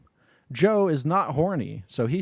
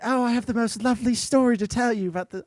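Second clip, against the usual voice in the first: high, quick, badly faked starlet voice; Marilyn Monroe; 9 semitones higher, faster than usual. Sugar gushing to her friends, failed starlet impression. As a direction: attempt a gushing starlet, pitched well up. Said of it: "I can't do Marilyn Monroe".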